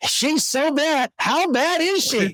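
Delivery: high, slow mock quote of the catchphrase. Host quotes the "so bad" line in a high, slow put-on voice. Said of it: "Remember the other".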